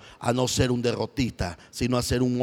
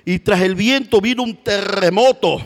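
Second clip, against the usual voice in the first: louder, higher call; rising preaching; 5 semitones higher, 5 dB louder than usual. Acted. Preacher to church, loud for the earthquake. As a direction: let the earthquake ring out.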